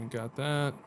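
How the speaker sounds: ordinary delivery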